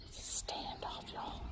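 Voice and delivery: very soft whisper to the viewers; hushed, voiceless narration. Real, not acted. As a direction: whisper it like a secret commentary. Whispers to viewers about animals' standoff.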